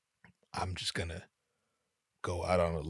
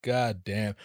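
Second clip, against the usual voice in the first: ordinary delivery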